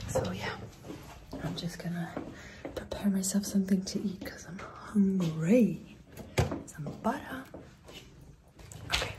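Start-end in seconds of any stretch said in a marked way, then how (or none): 1.4-9.1 quiet at night. Real, not acted. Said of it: "I'm not gonna talk too loud".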